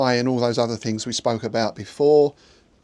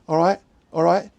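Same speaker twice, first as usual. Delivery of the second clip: higher, louder East End greeting; East End accent; 6 semitones higher, 5 dB louder than usual. Retold or imitated. East Ender repeating a little phrase, higher East End accent. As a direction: imitate East End verbal tics. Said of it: "like people from the East End say".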